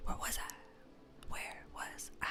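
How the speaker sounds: fading into a whisper. Shown as a demonstration. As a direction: drop to a breathy whisper. Repeats line fading to whisper.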